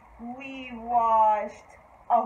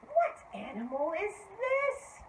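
usual speaking voice

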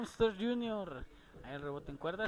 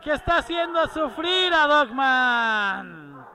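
louder and higher with each phrase; building shout; 4 semitones higher, 8 dB louder than usual. Real, not acted. Building call of the beating.

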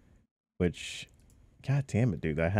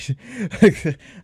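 laughing; 12 semitones higher, 8 dB louder than usual. Real, not acted. Laughs at damage.

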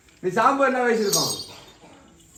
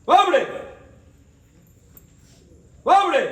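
high, forceful summons; commanding call; 7 semitones higher than usual. Real, not acted. Exorcist ordering the spirit to come, high commanding call.

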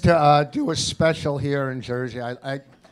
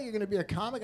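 incredulous; 6 semitones higher, 6 dB quieter, much faster than usual. Retold or imitated. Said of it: "I go".

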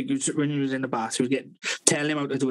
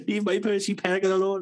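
higher, whining protest; whiny boy; 6 semitones higher than usual. Retold or imitated. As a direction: plead like a fussing teenager. Boy shouting in waiting room.

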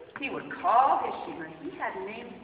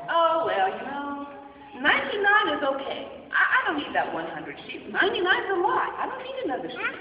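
high, loud offhand voice; mock careless shepherd; careless shrug; 4 semitones higher, 6 dB louder than usual. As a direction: brush it off like it doesn't matter.

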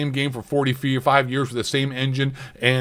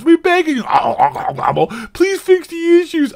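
mock begging; 14 semitones higher, 5 dB louder than usual. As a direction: beg the devs in a pathetic whine. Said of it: "begging the devs".

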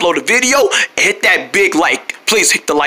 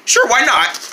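usual speaking voice

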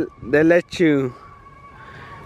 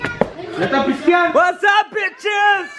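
shouted greeting; 9 semitones higher, much slower than usual. Real, not acted. Greeting friends on arrival.

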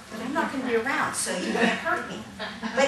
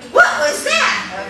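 mock surprise; 13 semitones higher, 9 dB louder, much slower than usual. Real, not acted. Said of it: "reacting to overdone demo".

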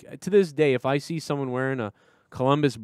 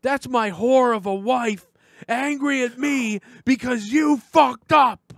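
much higher, slow, louder rant; hammed-up angry caller; 11 semitones higher, 4 dB louder, slower than usual. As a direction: ham up the outrage on the phone. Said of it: "I go".

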